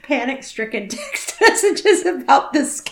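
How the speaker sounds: trying not to laugh; words breaking into giggles. Real, not acted. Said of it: "I'm trying not to laugh".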